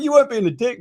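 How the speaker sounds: normal talking voice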